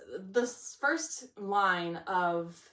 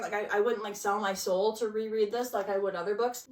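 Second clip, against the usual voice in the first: ordinary talk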